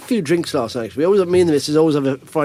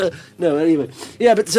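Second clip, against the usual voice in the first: normal talking voice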